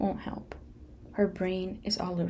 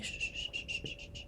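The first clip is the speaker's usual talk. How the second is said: soft repeated shh; calm her down with gentle shushes; soothing shushing; 11 dB quieter than usual. Retold or imitated. Daughter soothing her frightened mom with repeated soft shh. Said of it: "and I was like".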